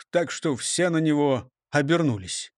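ordinary delivery, nothing marked

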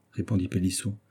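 ordinary delivery

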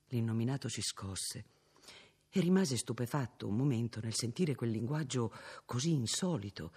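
usual speaking voice

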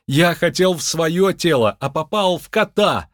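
high, distressed plea; pleading. Acted. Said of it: "умоляющим голосом". (умоляюще, с отчаянием)

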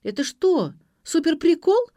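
loud, high outraged question; high-pitched acted indignation; indignant. A narrator's reading. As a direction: protest at a bad prank. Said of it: "возмутилась Ксения".

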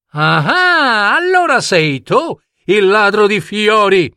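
high-pitched prince pouncing on the culprit; acted "aha" of discovery, pitched high; triumphant accusation. Prince confronts the flower thief. (accusa trionfante, con voce acuta)

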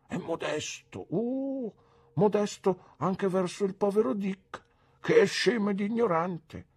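lightly voiced simple old man, eager and admiring; a hint of a childlike old man praising the doctor; mister Dick. A narrator's reading. Simple old man praises the doctor to the boy, eager and earnest.

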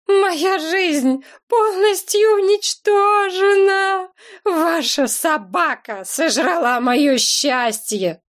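slow, tearful wail, starting high; sobbing. A narrator's reading. Heroine wailing at the dog owner through tears, slow sobbing. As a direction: keep sobbing through every word. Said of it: "сквозь рыдание твердила я".

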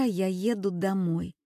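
ordinary delivery, nothing marked

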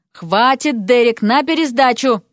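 ordinary delivery, nothing marked

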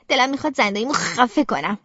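ordinary delivery, nothing marked

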